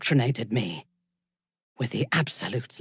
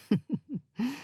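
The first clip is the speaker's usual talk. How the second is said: soft laugh; 9 semitones higher, 6 dB quieter than usual. Acted. Soft laugh after line.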